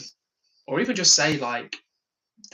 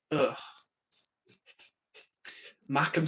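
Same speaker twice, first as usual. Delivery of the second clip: normal talking voice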